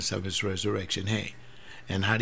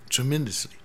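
ordinary delivery

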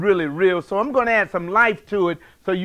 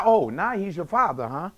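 ordinary delivery

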